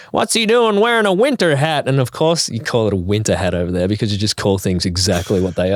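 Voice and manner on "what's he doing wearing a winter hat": lightly mimicked American. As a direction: put on a puzzled American onlooker, pitched high. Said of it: "I get Americans being like".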